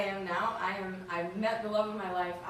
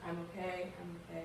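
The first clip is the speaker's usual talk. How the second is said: unconvincing reassurance; 2 semitones lower, 8 dB quieter than usual. Retold or imitated. Upset daughter lying to her mom on the phone, unconvincing reassurance. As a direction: say it softly, not believing it yourself. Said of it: "the biggest lies I've ever told anyone".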